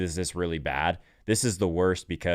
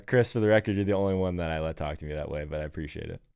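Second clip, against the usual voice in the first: ordinary talk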